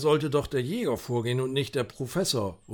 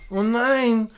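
alarmed; 7 semitones higher, 6 dB louder than usual. Acted. Finding the bound companion.

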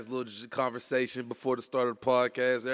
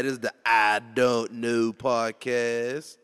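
normal talking voice

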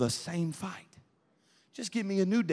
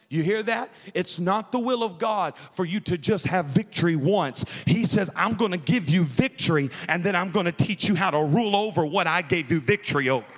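louder proclaiming; full-force preaching; 5 dB louder than usual. Acted. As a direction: declare it to the room. Preaching climax, quoting God.

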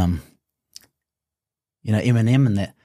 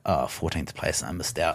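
mild disappointment; 5 semitones lower, 10 dB quieter than usual. Retold or imitated. Retold disappointment at results.

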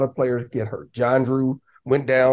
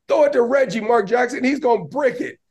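retold yelling; 9 semitones higher than usual. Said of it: "He was yelling at him".

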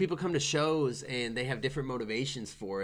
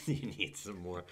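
laughing through the words, lower and softer; 6 semitones lower, 8 dB quieter than usual. Real, not acted. Cohost cracking up at his own taunt, laughing. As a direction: giggle the line out.

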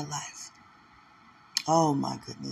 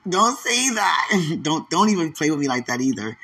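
playful protest; 3 semitones higher, 7 dB louder than usual. Real, not acted. Guessing a listener's name, loud playful protest. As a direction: cry out in mock objection, laughing.

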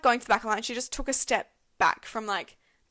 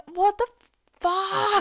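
high, loud disbelief with a break before the swear; retold outrage; 10 semitones higher, 7 dB louder, much slower than usual. Friends reacting to queue-jumper cutting again, outraged. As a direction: let the indignation burst out, pitched up.

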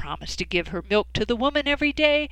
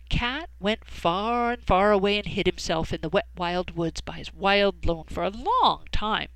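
plain reading voice

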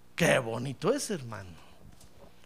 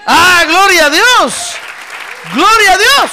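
much higher, very loud, drawn-out cry of praise; exultant shouted praise; 11 semitones higher, 15 dB louder, slower than usual. Real, not acted. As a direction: shout glory with full force. Preacher shouts praise to congregation. (grito de alabanza exaltado)

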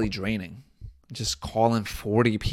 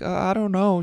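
lightly voiced unsure child, higher pitch; hint at a hesitant kid, pitched up; ten-year-old kid; 7 semitones higher than usual. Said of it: "Trying to sell to a ten-year-old".

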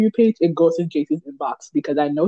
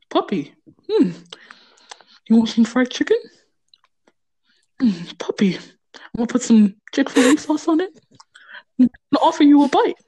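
Deku voice impression; 8 semitones higher than usual. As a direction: coax the puppy with chicken, sexual and playful. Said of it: "So I'm gonna do the one where he's offering you fried chicken".

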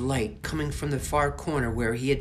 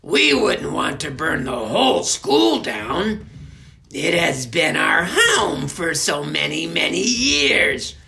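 higher, loud, slow disdainful voice; scornful witch; 4 semitones higher, 9 dB louder, slower than usual. Acted. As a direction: sneer about the children. Merkel scornful about children.

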